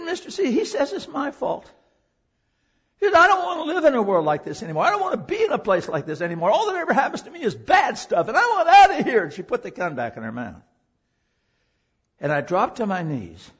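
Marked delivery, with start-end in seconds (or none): 0.0-9.2 high, loud, fast despairing cry; desperate teen girl. Retold or imitated. Preacher voicing Heather's cry with the gun in her mouth. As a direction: beg to escape, voice climbing.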